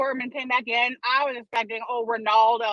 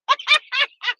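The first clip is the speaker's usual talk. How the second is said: high cackle; 5 dB louder than usual. Real, not acted. Laughing at own story.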